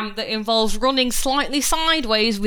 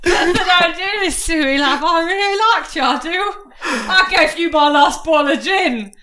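mock heartbroken pleading; 8 semitones higher, 3 dB louder than usual. Aubrey begging Bertha not to leave, hammed-up sob. Pleading to lover kicking her.

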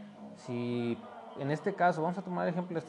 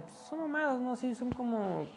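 mocking high voice; 10 semitones higher than usual. His past self dismissive.

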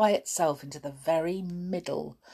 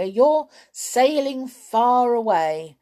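raised calling voice with mock drama; teasing shout; 7 semitones higher, 6 dB louder than usual. A narrator's reading. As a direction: call out as if across a pond. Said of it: "shouted Amelia".

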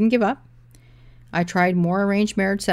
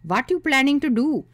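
put-on Indian accent; 8 semitones higher, 6 dB louder than usual. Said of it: "ones with thick accents and head bobbing".

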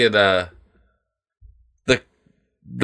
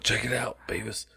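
much softer, fading mock voice; Butthead about to die; 12 dB quieter than usual. Gaunt Butthead dying on the couch, weak hushed voice. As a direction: let it drop away weakly. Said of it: "And he's like".

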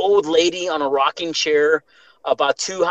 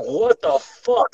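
startled; 5 semitones higher, much slower than usual. Retold or imitated. Scared awake by demon.

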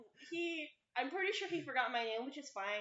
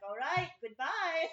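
slow professor goodbye; 4 semitones higher, 5 dB louder, slower than usual. Retold or imitated. Professor voice retold.

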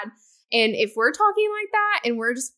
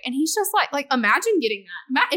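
normal talking voice

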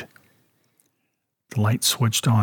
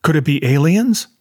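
ordinary delivery, nothing marked

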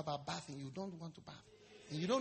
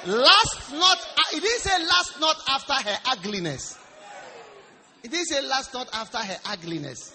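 high, loud shouted sermon; loud, emphatic preacher's cry; full-force preaching; 6 semitones higher, 15 dB louder than usual. Real, not acted. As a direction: shout the scripture point to the congregation.

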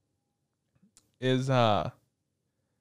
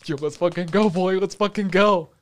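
higher, rising hype-man cheer; hyped cheer; 5 semitones higher, 3 dB louder than usual. Retold or imitated. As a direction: cheer like your team just scored. Hyped reaction to matching fortunes.

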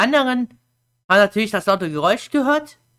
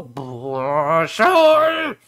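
acted exasperation; much slower than usual. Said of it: "da denkst du dir auch nur so".

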